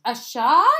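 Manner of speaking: loud high wail of alarm. A narrator's reading. April hears a shot is coming, wailing in alarm. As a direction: wail it, rising sharply. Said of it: "wailed April".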